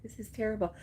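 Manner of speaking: ordinary talk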